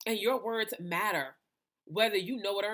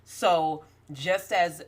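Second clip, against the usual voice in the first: louder, emphatic preaching voice; 7 dB louder than usual. Acted. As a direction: preach it to the congregation. Preaching to viewers.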